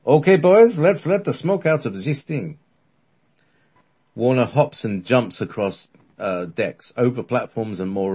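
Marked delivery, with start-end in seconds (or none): 0.0-2.6 put-on grinning mad scientist, German accent; gleeful madman in German accent; manic German. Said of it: "with the look of a madman".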